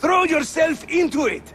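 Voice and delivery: calling out. Real, not acted. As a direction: shout encouragement up to a climbing friend. Calling to Ezio during the climb.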